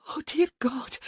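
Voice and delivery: whispered, breathless and high; breathy whisper. A narrator's reading. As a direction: whisper it in ecstasy. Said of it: "she chanted".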